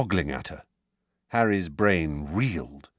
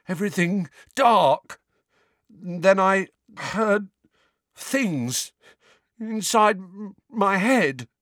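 put-on frightened boy; 12 semitones higher, 4 dB louder, slower than usual. Dudley recalls dementor terror.

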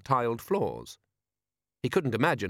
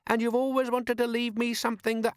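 put-on much higher eager boy's voice; young boy voice; 12 semitones higher than usual. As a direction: pitch up like an eager lad. Son character mocking.

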